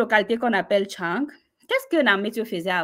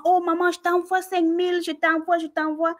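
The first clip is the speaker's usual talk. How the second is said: higher, showy voice of a brother bragging on the phone; imitating brother; 9 semitones higher than usual. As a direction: play the proud brother calling mom with money. Brothers voiced calling mom. (imitation d'un frère fier au téléphone, voix plus aiguë)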